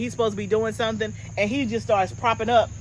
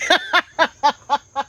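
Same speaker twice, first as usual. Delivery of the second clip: real laugh; 6 semitones higher, 3 dB louder than usual. Real, not acted. Laughing at own answer.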